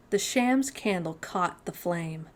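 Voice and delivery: ordinary delivery, nothing marked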